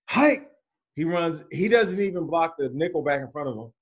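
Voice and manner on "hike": mock snap call. Quarterback calling the snap.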